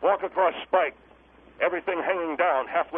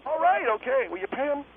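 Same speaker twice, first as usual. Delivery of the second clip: higher-pitched, flustered agreement; harried; 8 semitones higher than usual. Acted. Guest giving in about paying the musicians, harried. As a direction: give in, hurried and exasperated.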